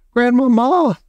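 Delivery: high, loud frightened shout; boy shouting. A narrator's reading. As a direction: shout up the name in fear. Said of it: "I shouted".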